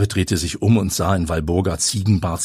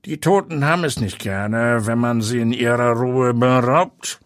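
a grumbling ghostly watchman, slightly higher; 4 semitones higher than usual. A narrator's reading. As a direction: grumble it like a graveyard keeper. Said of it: "brummte der Mann".

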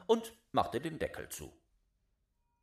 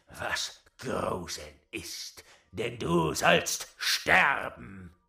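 lower, slow, rumbling threat; menacing old giant; 7 semitones lower, 5 dB louder, slower than usual. Acted. Old giant threatens the boy with death, slow and low. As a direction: loom over the little wretch.

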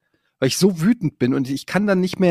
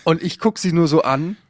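ordinary talk, nothing marked

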